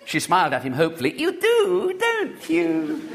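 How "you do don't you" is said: put-on high, slow childish lisp; lisping little girl. A narrator's reading. Little girl asks William's name, lisping high and slow. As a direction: lisp it like a spoilt small girl.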